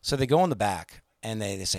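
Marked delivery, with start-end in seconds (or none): none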